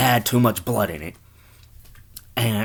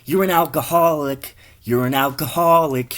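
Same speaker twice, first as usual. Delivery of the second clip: raised voice, louder accusing cashier; cashier's scolding; 6 semitones higher, 6 dB louder than usual. Acted. Cashier scolding the drinking customer, louder. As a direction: call him out loudly over the counter. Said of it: "the cashier is like".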